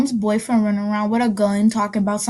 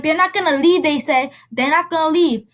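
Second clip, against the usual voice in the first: higher, pressing warning; insist, a bit panicky; child pressing her mother; urgent; 6 semitones higher than usual. Retold or imitated. Warning mom cops won't leave.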